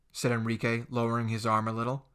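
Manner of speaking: ordinary delivery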